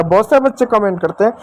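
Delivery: ordinary talk, nothing marked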